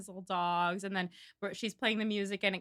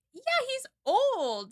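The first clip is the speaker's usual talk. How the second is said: put-on incredulous teen; 14 semitones higher, much slower than usual. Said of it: "she's like".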